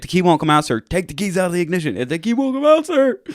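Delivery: high, loud, frantic shouting match; escalating high-pitched retold yelling; yelling back and forth. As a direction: act out a panicked argument with a cop. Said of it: "he's fucking yelling at me".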